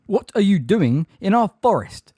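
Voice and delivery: loud, high angry demand; bellowing. A narrator's reading. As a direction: bellow the question at a trespasser. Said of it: "bellowed the hard-faced grey centaur".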